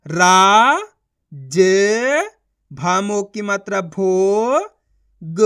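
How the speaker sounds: रा loud and long, then ज, भो, ग apart; syllable sounding out. Shown as a demonstration. Teacher spelling for learners, sounding out. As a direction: hold each letter, भो drawn out. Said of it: "उनका उच्चारण करो".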